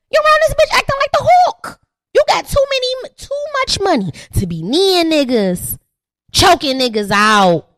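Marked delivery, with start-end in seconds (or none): 0.1-1.8 louder, higher repeat. Shown as a demonstration. 2.1-3.8 high, loud mock outrage at the player; outraged yell. Comic host yelling at Draymond about fighting.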